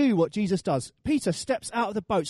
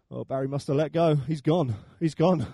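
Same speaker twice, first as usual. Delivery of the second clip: mock lament; 7 semitones lower than usual. Churchgoers lament lost Barry.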